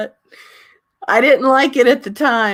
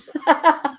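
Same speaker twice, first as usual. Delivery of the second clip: laugh; 8 semitones higher than usual. Real, not acted. Laughing at memory.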